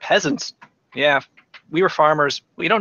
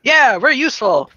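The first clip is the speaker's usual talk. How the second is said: high, loud, eager cheer; excited little outburst; eager Jin; 10 semitones higher, 8 dB louder than usual. Pretend. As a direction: pipe up proudly as a keen recruit. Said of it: "goes Jin".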